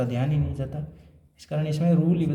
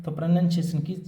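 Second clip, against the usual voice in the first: ordinary delivery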